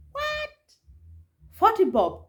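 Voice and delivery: high squeaked "what", then incredulous repeat; mock shock. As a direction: act out disbelief at a price. Retelling shock at avocado price.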